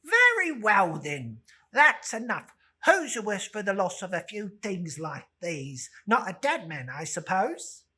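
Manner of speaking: loud, brassy woman daring them; defiant charwoman. Acted. Charwoman justifying the theft to the others, loud and bold. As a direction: throw your defiance at them.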